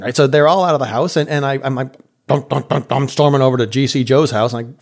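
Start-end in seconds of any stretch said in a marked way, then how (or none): none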